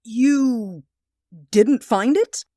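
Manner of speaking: can't believe it isn't there; stunned disbelief. Acted.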